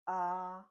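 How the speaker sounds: AH held high and level; first tone. Shown as a demonstration. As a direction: Mandarin first tone on AH, high, steady and loud. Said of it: "The first tone is this".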